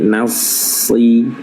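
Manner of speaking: "nasi" drawn out slowly, S heavy from the start; slow correct recitation. Shown as a demonstration. Teacher slowly models the correct sound for students. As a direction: slowly say "nasi" with the S thick at once. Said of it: "Jangan nas, tapi".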